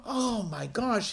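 slow, high-pitched moan of dismay; falling apart. Acted. Father taking in the death, slow dismayed moan. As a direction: let it out slowly as you crumble. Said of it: "he begins to fall apart".

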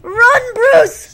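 high, loud, desperate yell; panicked shout. Real, not acted. Gamer urging Bruce to escape, panicked shout. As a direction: yell it after a fleeing friend. Yelling at game ally to flee.

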